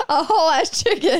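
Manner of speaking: laughing while talking; words through a laugh. Real, not acted. Laughing at the choking story.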